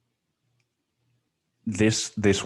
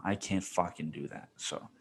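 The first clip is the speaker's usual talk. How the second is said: ordinary talk, nothing marked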